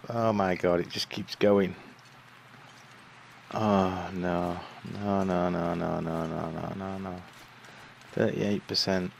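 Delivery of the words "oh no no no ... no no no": trailing off in dismay; despairing no fading. Real, not acted. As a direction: fade each no quieter.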